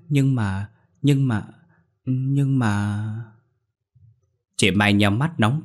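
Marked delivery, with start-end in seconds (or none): 0.1-3.0 ngập ngừng. A narrator's reading. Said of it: "ngơ ngác hỏi".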